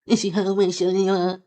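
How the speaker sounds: high mocking whine of the caller's words; sneering impression of an emotional caller.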